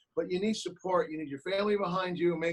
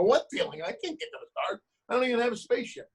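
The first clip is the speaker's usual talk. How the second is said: mock puzzled kid, pitched much higher; young kid self; 7 semitones higher than usual. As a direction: play the confused boy protesting. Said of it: "I go".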